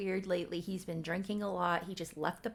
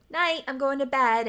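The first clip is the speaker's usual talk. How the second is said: louder, higher mock sign-off; retold abrupt goodnight; 7 semitones higher, 8 dB louder than usual. Boyfriend abruptly leaving girlfriend for bed, loud mock sign-off. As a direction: call it out suddenly, imitating a brush-off.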